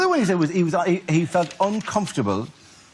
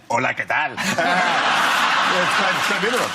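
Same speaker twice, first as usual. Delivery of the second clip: Spanish accent; faster than usual. Shown as a demonstration. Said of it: "my Spanish accent".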